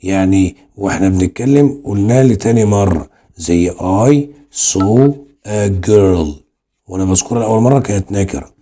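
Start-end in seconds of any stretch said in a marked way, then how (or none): 3.7-6.3 very slow, word by word; slow dictation. Shown as a demonstration. Teacher to students, dictating the example very slowly. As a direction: read the example out slowly for copying.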